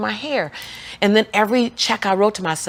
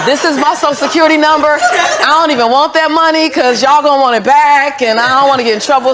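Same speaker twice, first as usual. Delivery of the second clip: higher, louder, laughing through it; laughing disbelief; 8 semitones higher, 7 dB louder than usual. Retold or imitated. Lisa laughing as she refuses the bank balance. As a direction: protest through giggles.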